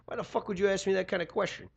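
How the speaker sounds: ordinary talk, nothing marked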